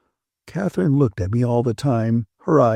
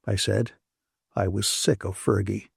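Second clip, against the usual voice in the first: ordinary delivery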